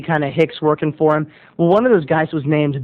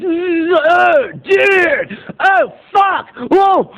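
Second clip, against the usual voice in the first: panicked yelp; 10 semitones higher, much slower than usual. Retold or imitated. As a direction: yelp as if just zapped. Dude electrocuted, yelping.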